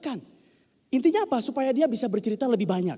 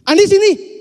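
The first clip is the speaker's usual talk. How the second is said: much higher and louder, snapping the name out; sharp shout; 9 semitones higher, 12 dB louder, slower than usual. Shown as a demonstration. Teacher shows an angry summons, loud and sharp. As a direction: call him over like you're fed up. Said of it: "Itu kan beda banget".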